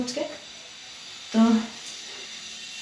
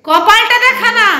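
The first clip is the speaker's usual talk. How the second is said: raised coaxing plea to the son; insistent call; 10 semitones higher, 5 dB louder, slower than usual. Real, not acted. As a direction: call him louder, pleading a little. Mother insists son show forehead.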